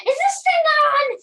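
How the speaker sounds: high, very loud mock frustration; hammed-up exasperated shout; exasperated yell. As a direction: yell at a wand that won't work. Trick failing, yelling at wand.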